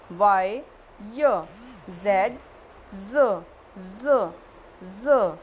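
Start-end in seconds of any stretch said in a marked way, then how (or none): none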